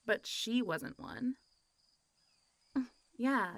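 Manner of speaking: usual speaking voice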